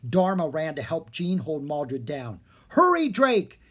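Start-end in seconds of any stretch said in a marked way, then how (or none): 2.7-3.6 higher, louder urgent call. A narrator's reading. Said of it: "he called up".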